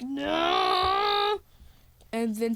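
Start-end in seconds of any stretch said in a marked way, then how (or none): 0.0-1.4 long "no" climbing in pitch; hammed-up falling villain cry; mock drawn-out wail. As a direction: stretch it out in pretend despair. Villain being shaken off truck.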